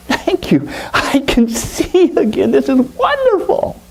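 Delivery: very high, breathless cries of joy; overjoyed blind boy. Acted. Blind boy sees the lake and shore, very high and overjoyed. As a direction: let the thanks spill out high and amazed.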